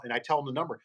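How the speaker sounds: usual speaking voice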